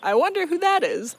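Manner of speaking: high, loud, exaggerated curiosity; mock wondering. Storyteller mocking Feyre's curiosity about the mysterious her, hammed-up wondering. Retold heroine's naive musing, mocked.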